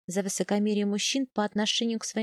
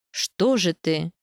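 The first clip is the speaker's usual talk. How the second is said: a much lower, slow, puzzled question; drawn-out, wondering ask, lower and louder; surprised; 5 semitones lower, 4 dB louder, much slower than usual. A narrator's reading. The husband asks his wife why she left the stall: surprised. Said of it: "удивлённо спрашивает степняк".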